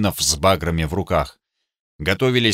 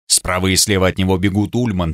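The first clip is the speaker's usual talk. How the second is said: ordinary delivery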